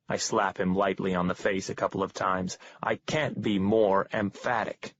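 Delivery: ordinary talk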